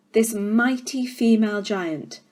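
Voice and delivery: ordinary delivery, nothing marked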